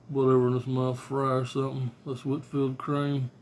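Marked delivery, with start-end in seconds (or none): none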